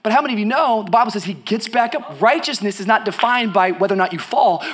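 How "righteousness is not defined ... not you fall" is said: normal talking voice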